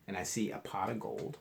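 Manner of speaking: plain reading voice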